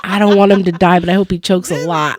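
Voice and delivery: loud, laughing spite; laughing rant. Real, not acted. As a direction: cackle through the grudge. Laughing venting about husband.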